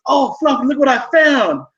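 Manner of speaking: high, sing-song call to a pet; sweet mock call. Buddy calling happily into the bathroom. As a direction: coo it like finding a treasure for a pet.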